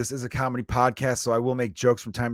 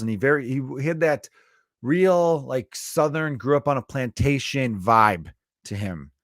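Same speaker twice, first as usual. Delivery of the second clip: ordinary talk, nothing marked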